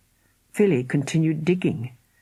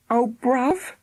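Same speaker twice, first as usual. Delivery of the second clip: sighing; 8 semitones higher than usual. A narrator's reading. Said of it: "sighed Philly".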